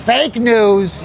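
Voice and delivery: hammed-up presidential quote; Trump impression. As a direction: punch it like the president. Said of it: "you gotta talk like this".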